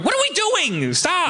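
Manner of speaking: high, frantic protest; mock panicked cry. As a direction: squeal it like someone panicking. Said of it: "people that keep going".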